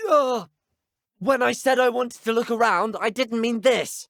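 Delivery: high, strained protest; exasperated outcry. Acted. Student protests at the poltergeist's spinning, exasperated. Protest while being spun about.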